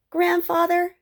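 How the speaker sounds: put-on high young Joey calling fondly; smiling boy. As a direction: say it through a smile, high.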